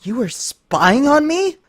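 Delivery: high, slow stricken question; hurt disbelief. Acted. Twilight realizing Lyra spied on her, choked hurt. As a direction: ask it past a lump in your throat.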